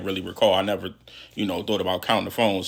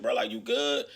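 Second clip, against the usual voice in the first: high, softer questioning voice; concerned check-in; 12 semitones higher, 5 dB quieter than usual. Retold or imitated. Inmate asking a crying bro, high soft check-in. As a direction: imitate a careful check on a friend.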